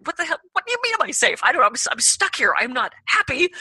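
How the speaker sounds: high, loud, disbelieving; indignant outburst. Retold or imitated. Stranded guest snapping back at the innkeeper, high and loud. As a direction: bristle at the silly question.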